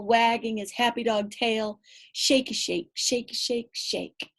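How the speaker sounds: plain reading voice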